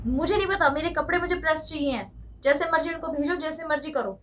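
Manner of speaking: loud, high, snapping insistence; angry demand. Acted. Daughter-in-law demanding her clothes be pressed, loud and angry. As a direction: refuse to listen and demand it loudly.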